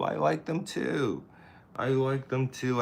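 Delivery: high "I like them too"; high, happy agreement. Real, not acted. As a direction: lift it up, pleased. Agreeing happily.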